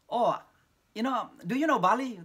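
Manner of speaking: high, loud, eager question to the audience. Retold or imitated. Speaker retelling his lecture opener to American students, eager and loud. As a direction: pitch Bali to them with a bright hook. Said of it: "biasanya strategi saya".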